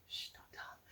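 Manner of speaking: conspiratorial whisper to the viewers. Real, not acted. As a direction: hush it like sharing a secret. Sharing a secret with viewers.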